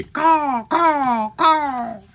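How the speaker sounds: three very high, drawn-out caws; crow caw. Acted. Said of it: "What does the crow say?".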